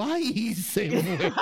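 high yelp of dismay, ay se fue; mock yelp. Telling friends about the accident, a high yelp of dismay. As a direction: squeak it out as the moment goes wrong. Retelling the moment it slipped out.

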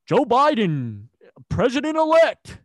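high, loud, slow mock news announcement; mock announcer. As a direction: announce it like a TV anchor.

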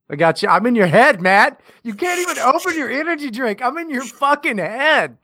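high-pitched mock haunting of a friend; gleeful taunting. Teasing as voice in co-host's head.

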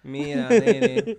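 loud, slow hammed-up Spanish; exaggerated Spanish. Shown as a demonstration. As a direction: drag out "Mira, nene" loudly. Mock repeat of the phrase.

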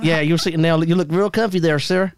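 put-on Texan courtesy; mock Texan small talk; Texan accent. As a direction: say it like a polite Texan. Two Texans.

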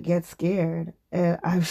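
usual speaking voice

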